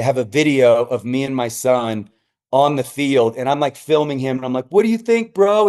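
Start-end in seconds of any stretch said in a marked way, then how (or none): 4.7-5.7 high, thrilled question; excited dad. Retold or imitated. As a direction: ask with beaming excitement. Dad excited asking son.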